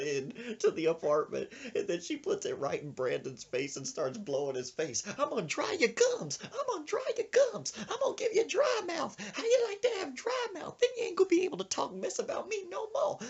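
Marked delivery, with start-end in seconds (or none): 5.2-13.2 frantic high falsetto threats; mock-crazed granny on the attack; vengeful old woman. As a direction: yell taunts in a squealing old-lady voice. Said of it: "this old woman had a leaf blower".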